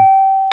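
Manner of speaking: high piercing animal squeak; guinea pig squeal. Pretend. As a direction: squeal like a little rodent. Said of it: "[name]'s guinea pig impression".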